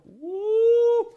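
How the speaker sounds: high, loud sustained woo; cheering whoop. Real, not acted. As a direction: let out a held whoop. Cheer for team in playoff hunt.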